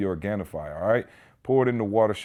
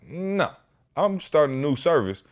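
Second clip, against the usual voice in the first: higher, firm insistence on a new can; mock indignant demand; 8 semitones higher, 4 dB louder than usual. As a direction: imitate himself demanding a brand-new trash can. Retold demand to trash company.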